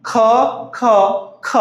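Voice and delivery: K said as "kuh"; letter sound. Shown as a demonstration. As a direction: say K as "kuh", not "kay". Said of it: "K sounds like K".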